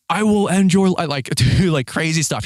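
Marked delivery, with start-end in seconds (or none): 0.1-1.1 high mock scream, a little louder; retold screaming. Driver threatens man from truck, high retold yell. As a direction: re-enact screaming a threat out the window. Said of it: "I'm screaming out the window".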